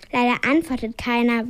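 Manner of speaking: ordinary delivery, nothing marked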